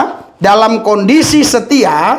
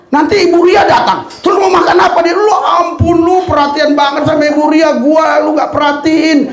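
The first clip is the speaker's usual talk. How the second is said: mock teasing child; 8 semitones higher than usual. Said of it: "kan dia bilang".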